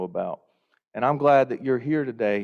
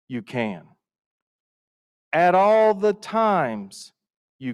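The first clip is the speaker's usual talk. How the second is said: slow, weighty, each "all" leaned on; measured like a sermon, pausing between clauses; solemn recitation; 3 dB louder, much slower than usual. Retold or imitated. Quoting Billy Graham to the conference, slow and solemn.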